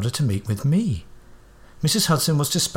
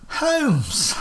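much higher surprised cry; exclaiming. Acted. Watson recognising Holmes in disguise, exclaiming. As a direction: blurt it out in shock. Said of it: "I exclaimed".

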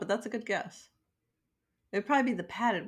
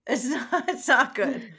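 laughing, higher and louder; amused echo of it's not good; laughing repeat; 6 semitones higher, 6 dB louder than usual. Real, not acted.

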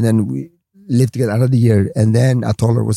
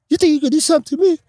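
challenging gangster; 13 semitones higher, faster than usual. Retold or imitated.

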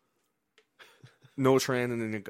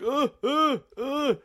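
high-pitched mock grunts, louder than talk; imitated grunts; 6 dB louder, much slower than usual. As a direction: imitate a man going "uh, uh, uh" high and loud. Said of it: "He's just like".

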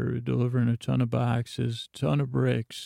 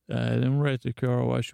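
normal talking voice

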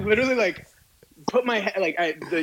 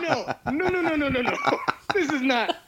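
laughing disbelief; 6 semitones higher than usual. Retold or imitated. Disbelief at the excuse.

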